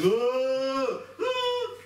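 startled, rising cry of alarm; high panicked gasping oh; frightened cry. Acted. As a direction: cry out in sudden fear. Servant waking to see army.

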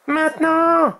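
very high-pitched cry; high young dragon shout; shouted signal. A narrator's reading. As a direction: yell the cue sharply. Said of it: "cria Oria".

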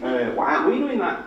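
very high, fawning mock squeal; clucking designers. Designers gathered round an admired website, clucking enviously. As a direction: cluck over it like excited hens. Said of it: "like, clucking, you know".

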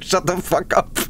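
playful imitation of a snapping old astronaut; mock gruff Buzz Aldrin. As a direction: throw in a jokey snarl like a cranky eighty-year-old. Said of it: "He's like".

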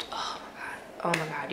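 soft unvoiced whisper. Real, not acted. Amazed at the glasses to viewers, whispered. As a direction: breathe it in disbelief. Hushed awe.

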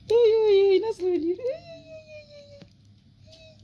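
loud high delighted cooing. Real, not acted. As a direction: coo with glee at the kitten. Delighted at the cat. (kediye sevinçle oy oy deme)

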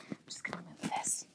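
muffled, off-mic whisper. Real, not acted. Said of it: "you're gonna just hear my muffled voice now".

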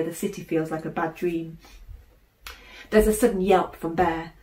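ordinary delivery, nothing marked

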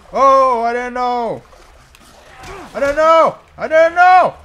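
high, loud pleading yell; frantic pleading. Pretend. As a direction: plead loudly for mercy, hammed-up. Pleads with attacking enemy.

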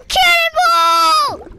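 long, high, loud drawn-out yell; cannonball yell. Real, not acted. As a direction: stretch it out as you jump. Jumping into the pool.

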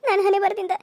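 tearful, self-blaming lament; sobbing despair; weeping. Acted. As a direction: cry helplessly while blaming your own fate. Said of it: "ನೀ ಅಳ್ಬೇಡವ್ವ".

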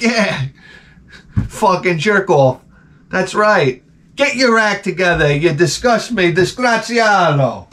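theatrical jeering, last word drawn out; mock scolding mob. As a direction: berate him like an outraged townsman. Acted crowd abusing the man.

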